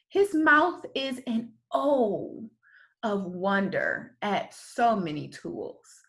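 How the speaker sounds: plain reading voice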